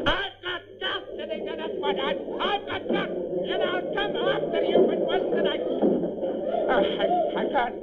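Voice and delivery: high, strained comic fury; overexcited outburst. Acted. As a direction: blow up, voice shooting way up. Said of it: "I'll get overexcited".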